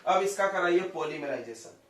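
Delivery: usual speaking voice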